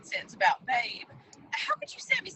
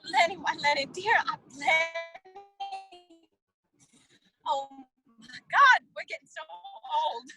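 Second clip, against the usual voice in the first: emotional cry; 5 semitones lower, 4 dB louder than usual. Acted. Meg emotional reunion.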